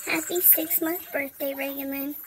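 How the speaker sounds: low, soft birthday wish; quiet, heartfelt murmur of love; tender. Real, not acted. Tender closing wish to her baby.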